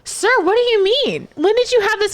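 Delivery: high, squeaky mock of a patient coworker. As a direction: sing it up high like a fussy nurse. Mocking coworker questioning confused patient.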